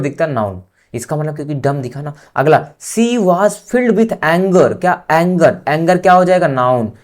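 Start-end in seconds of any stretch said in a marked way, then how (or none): 2.8-4.8 very slow, word by word; slow dictation to a class. Shown as a demonstration. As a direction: read it for students to copy. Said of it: "dictating an example sentence slowly".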